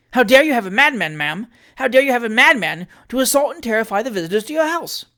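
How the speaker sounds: high, loud scolding rant; indignant tirade. Acted. Trott blaming the landlady for the madman, loud angry scolding. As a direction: pour out outrage at the landlady.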